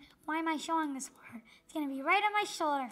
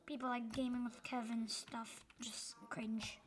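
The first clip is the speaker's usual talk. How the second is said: hushed whispered aside; whispering; 3 semitones lower, 8 dB quieter than usual. Real, not acted. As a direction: whisper it like a secret. Aside about cringe YouTubers.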